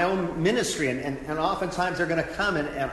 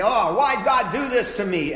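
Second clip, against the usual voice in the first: louder, higher, hammed-up grieving protest; mock angry outburst; 7 semitones higher, 7 dB louder than usual. A grieving person crying out angrily at God. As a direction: voice the griever lashing out at God, raised. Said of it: "they're gonna get angry and say".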